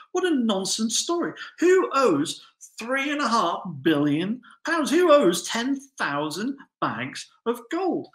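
high, loud disbelief at an absurd story; high-pitched astonished scoffing at a ridiculous debt; incredulous crowd. Retold or imitated. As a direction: play the stunned listeners scoffing. Crowd's incredulous reaction voiced.

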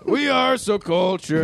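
put-on slow, high hammy French accent; bad French accent. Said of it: "Nobody in this room can do a French accent".

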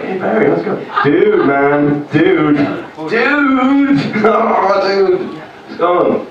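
high, bright matey greeting full of dudes; chummy greeting. Retold or imitated. Acquaintances greeting him, lightly voiced chummy dude talk. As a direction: greet a friend whose name you forgot. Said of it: "So they're like".

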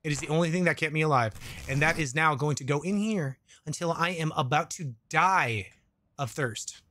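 usual speaking voice

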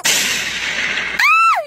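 loud, very high shriek; high scream. Acted. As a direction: scream out in horror. Jinxed student cries out.

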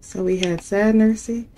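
usual speaking voice

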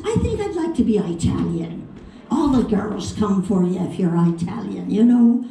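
put-on chatty Irish accent. Irishman wishing he were Italian, Irish accent. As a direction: confide a wish in an Irish accent. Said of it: "this is over in Ireland".

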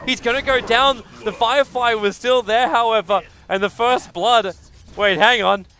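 loud, high-pitched esports caster; excited play-by-play. Real, not acted. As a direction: call the kill at full force, high and loud. Live play-by-play at full excitement.

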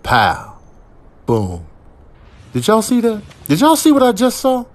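usual speaking voice

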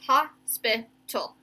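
"Hospital" slow, split into three beats; syllable by syllable. Shown as a demonstration. Teacher letting pupils hear the breaks, "Hospital" in slow syllables. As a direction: separate each syllable of "Hospital". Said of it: "You can hear that".

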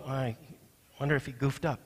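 quiet mock doubting. Imagined doubter questioning God's bookkeeping, in a quiet aside. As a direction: drop it down like a private doubt.

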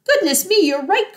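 high exclamation of sudden realisation; surprised cry. A narrator's reading. Mister Fox agreeing with his little son, crying out surprised. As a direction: start high, struck by the thought. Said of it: "cried Mister Fox".

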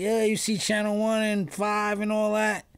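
high, loud urgent blurting; worked-up phone call. Retold or imitated. Retells urgent call to lawyer.

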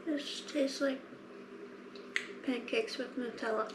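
talking between bites; while chewing. Real, not acted. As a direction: mouth partly full. Seen 1: Woman bites snack just before, talks with lips half-closed, holding it.

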